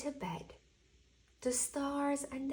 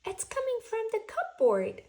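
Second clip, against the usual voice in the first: higher, louder, fast Owl voice; Owl excited; 12 semitones higher, 8 dB louder, faster than usual. Acted. Owl finds the noise's source, fast and excited. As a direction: rush it out, Owl is onto something. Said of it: "said Owl".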